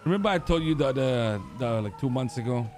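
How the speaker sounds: normal talking voice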